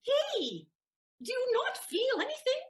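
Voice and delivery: high, emphatic insistence; pushing him to understand; insistent emphasis. Acted. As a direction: drive the point home high. Susanna pressing the point hard.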